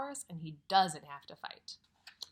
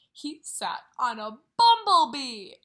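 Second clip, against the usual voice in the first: louder, punched reveal; dramatic reveal; 14 dB louder than usual. A narrator's reading. Teacher reading to kids, the bull sits on a bee, loud reveal. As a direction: land the surprise with a loud burst. Story's funny climax.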